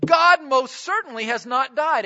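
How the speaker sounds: angry retort. Retold or imitated. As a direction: Luther firing back, offended. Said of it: "And he became angry".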